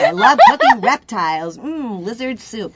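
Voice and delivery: loud, relishing mock boast, drawn-out end; mock gushing resume line. Coach jokingly voices student's odd resume skill, mock-gushing. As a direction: gleefully ham up a quirky skill, then savor it slowly.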